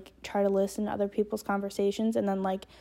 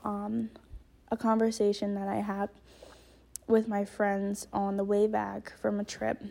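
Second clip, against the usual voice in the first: usual speaking voice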